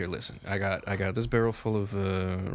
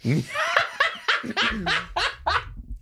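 a long, rising, high-pitched burst of laughter. Real, not acted. Player laughs at the busted-lip joke, high.

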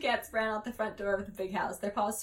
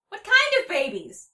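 shouted question; 11 semitones higher, 10 dB louder than usual. A narrator's reading.

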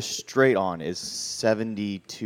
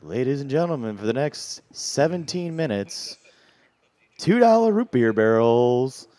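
slow announcer build, higher and louder to the end; bar manager voice; 4 semitones higher, 5 dB louder, slower than usual. Acted. Re-enacting a drink special call for the crowd, manager's announcer voice. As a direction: draw out the promo like a strip club DJ. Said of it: "I did it in the Hot Shots manager voice".

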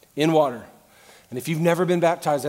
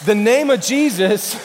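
loud, higher-pitched pulpit preaching; raised voice, driving the point home; full-force preaching; 8 semitones higher, 9 dB louder than usual. Acted. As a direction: preach it hard to a big congregation.

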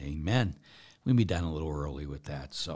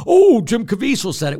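starstruck fan; 14 dB louder than usual. Retold or imitated. Mimicking starstruck people.

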